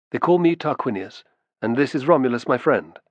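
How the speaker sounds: ordinary delivery, nothing marked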